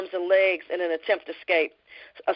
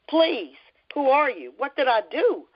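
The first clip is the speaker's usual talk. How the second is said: higher, slow, stumbling terrified plea; terrified pleading; 6 semitones higher, slower than usual. A narrator's reading. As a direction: beg in fear, words tripping out. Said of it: "Her words stumbled out of her mouth as her body shook with horror".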